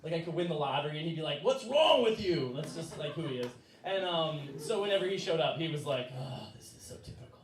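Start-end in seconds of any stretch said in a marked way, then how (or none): 1.4-2.5 loud, high, drawn-out scolding; exasperated dad. Retold or imitated. Dad to a lottery-winning child, mock exasperated yell. 6.2-7.4 hushed, sighing mutter; disgusted dad. Retold or imitated. Dad seeing the unpacked mess, mock exasperated sigh.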